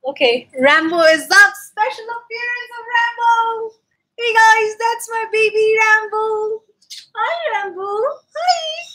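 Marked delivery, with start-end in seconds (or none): 1.7-8.8 high squeaky voice for the dog; pet voice. Real, not acted. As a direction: go all squeaky, talking to the pet. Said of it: "that was my pet voice".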